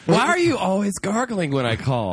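high, mocking voice of the cheating girlfriend. Friend mocking the cheating girlfriend, high mock voice. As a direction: sneer it up high like a nagging girlfriend.

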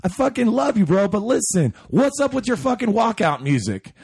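high-pitched, hammed-up club drunk; loud and boisterous. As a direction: imitate a boisterous drunk hailing a hero. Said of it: "I'm very loud and boisterous".

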